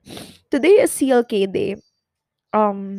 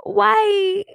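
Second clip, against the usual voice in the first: anguished why; 9 semitones higher than usual. Retold or imitated. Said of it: "I was like".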